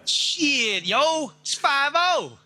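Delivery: high-pitched Baltimore projects call; mock Baltimore street lookout calling out; Baltimore accent. Shown as a demonstration.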